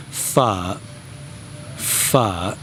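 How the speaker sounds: pho drawn out with a low dipping curve; rounded tone. Shown as a demonstration. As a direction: model pho slowly, going round. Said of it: "So that's pronounced".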